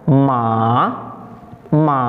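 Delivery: second-tone "Ma", lifted at the end; rising tone. Shown as a demonstration. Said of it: "seperti itu ya".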